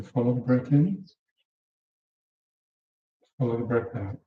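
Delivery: usual speaking voice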